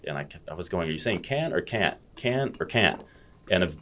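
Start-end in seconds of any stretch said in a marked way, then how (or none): 0.9-3.0 puzzled asking. Retold or imitated. Retold puzzled question to a student.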